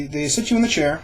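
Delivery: ordinary talk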